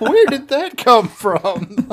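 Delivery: high, loud, laughing and slow; laughing disbelief. Retold or imitated. Podcast host reliving the movie's strangest line, laughing disbelief. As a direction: blurt it out through laughter, stunned. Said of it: "I was just like".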